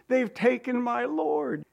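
high, drawn-out lament; heartbroken. Acted. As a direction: play Mary's grief, high and slow. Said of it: "She's heartbroken".

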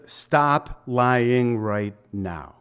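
drawn out, each word weighted; a very slow, stern command; slow firm command. Acted. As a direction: say it slowly like a firm order. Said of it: "So he just says".

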